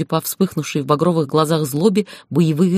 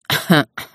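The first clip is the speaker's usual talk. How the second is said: raspy crow-like cough; croaking cough. A narrator's reading. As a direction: caw out a rough cough. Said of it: "натуральное карканье".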